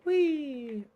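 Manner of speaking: high, gliding squeal of "whee"; playful "whee". Pretend. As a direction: let out a long, falling "whee". Playful cry while playing.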